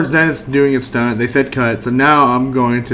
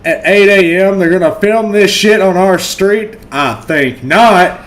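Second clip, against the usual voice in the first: higher-pitched outraged homeowner; indignant protest; 4 semitones higher, slower than usual. Acted. Homeowner objects to filming on the street, indignant. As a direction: protest indignantly, slowing on the last words.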